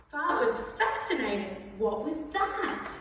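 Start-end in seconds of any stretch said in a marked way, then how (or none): none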